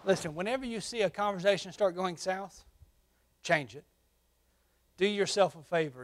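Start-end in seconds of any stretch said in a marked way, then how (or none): none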